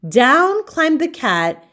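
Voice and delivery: ordinary delivery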